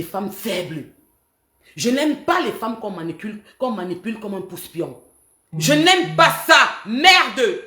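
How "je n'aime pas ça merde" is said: loud, higher, slow outburst; angry outburst. Real, not acted. Fed up with the calls about Marie Sandra, loud outburst. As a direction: spit it out in rage. Outburst ending her rant.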